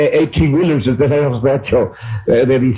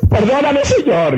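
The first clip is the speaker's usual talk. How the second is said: tearful plea; 12 semitones higher than usual. Retold or imitated. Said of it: "entre lágrimas y entre llanto".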